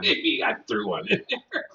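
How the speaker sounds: laughing through the words. Real, not acted. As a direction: giggle while joking. Laughing at stink bug joke.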